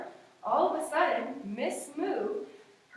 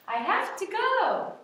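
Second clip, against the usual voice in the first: high, slow exit; 8 semitones higher, 5 dB louder than usual. Acted. Miss Moo leaving in story.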